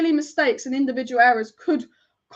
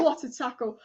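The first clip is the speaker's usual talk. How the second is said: ordinary talk, nothing marked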